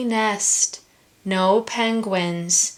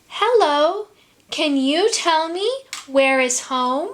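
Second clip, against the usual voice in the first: lightly voiced little penguin, higher; small penguin voice; 7 semitones higher than usual. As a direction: ask hopefully like a small chick. Little penguin asks the whale.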